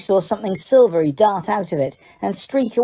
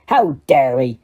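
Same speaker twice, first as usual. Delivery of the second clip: growled; 6 semitones lower than usual. A narrator's reading. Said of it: "Hagrid growled".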